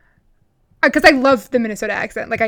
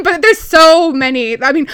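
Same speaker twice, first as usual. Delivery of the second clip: usual speaking voice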